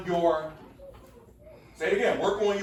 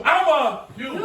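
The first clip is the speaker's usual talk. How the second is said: sassy, higher and louder call; with attitude; 6 semitones higher, 6 dB louder than usual. Shown as a demonstration. Teacher shows the class how to say it with attitude. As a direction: throw some attitude into it. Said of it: "Come on, say it with an attitude".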